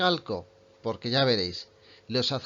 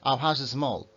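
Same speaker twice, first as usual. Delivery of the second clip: fast speech. Shown as a demonstration. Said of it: "cuando estamos hablando muy rápido".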